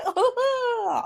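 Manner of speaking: high, fake sobbing "ah ah"; mock whiny crying. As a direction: whimper it out like a hammed-up crybaby. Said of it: "You see?".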